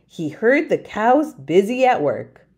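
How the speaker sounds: plain reading voice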